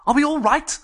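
called out high and loud; Benchley calling out to the others, high and loud. Acted. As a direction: call across to the other cells. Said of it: "called Benchley".

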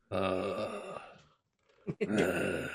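low wordless bear grunt. Acted. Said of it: "I'm going full bear".

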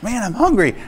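pleasantly surprised by his own appetite; cheerful surprise. Retold or imitated. Recalling waking healed at breakfast time, bright.

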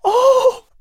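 very high, loud joyful gasp; surprised cry of joy; exclaimed. Acted. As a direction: burst out in delight. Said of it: "she exclaimed".

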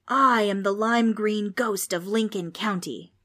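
loud, grand ghostly proclamation; dramatic introduction. A narrator's reading. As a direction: announce yourself with showy grandeur. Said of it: "After the ghost's dramatic introduction".

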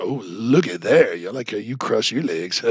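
lightly voiced mock Biden leering at the wife; Biden impression. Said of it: "So he's like".

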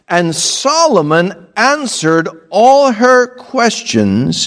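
scripture reading. Acted. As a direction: read the verse out. Reads scripture aloud.

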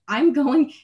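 re-enacted distress, high and loud; mock bawling. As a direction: imitate a panicked wail without real tears. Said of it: "bawling my eyes every single changeover".

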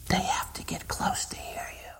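breathy whispered hush, drawing listeners close; whisper demo. Shown as a demonstration. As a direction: whisper it as if leaning in to one person. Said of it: "When you whisper to someone".

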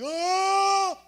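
imitated cry. Beaten man cries out in tears.

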